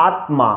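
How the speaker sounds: আত্মা with the m sounded clearly, not doubled t; সংস্কৃত উচ্চারণে আত্মা; Sanskrit pronunciation. Shown as a demonstration. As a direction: say আত্মা as aatma, m fully voiced. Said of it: "একটু সংস্কৃত উচ্চারণ করতে যাই".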